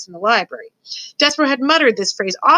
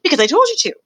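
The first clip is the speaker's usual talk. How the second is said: higher, insistent royal order; insistent father; 7 semitones higher, 4 dB louder, faster than usual. A narrator's reading. King overruling his daughter, insistent. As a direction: lay down the law, pitched up.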